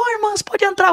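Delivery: high mock-polite mother; mother's voice. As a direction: greet the visitors brightly. Mother greets the sisters.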